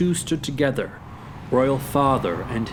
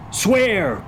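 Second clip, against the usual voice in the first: high, emphatic command; crying out; 8 semitones higher than usual. Acted. Hamlet demanding an oath from comrades, crying out. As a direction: cry the order out. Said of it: "cried Hamlet".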